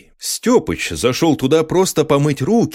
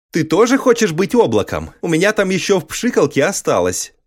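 put-on higher eager child's voice; 7 semitones higher than usual. A narrator's reading. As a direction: offer it like a proud kid. (детский голос)

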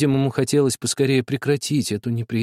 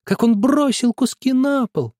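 shrill accusation; 10 semitones higher, 3 dB louder than usual. A narrator's reading. Nadezhda insists to father she saw it, shrill. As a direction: insist at the top of her voice.

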